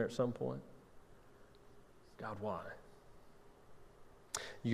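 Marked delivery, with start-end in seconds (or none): 2.2-2.6 very soft, falling question to God; small, low, acted cry of why; hushed plea. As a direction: barely voice it, as a private despairing prayer. Acting out a despairing question.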